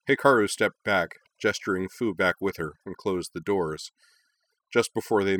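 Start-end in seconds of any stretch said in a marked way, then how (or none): none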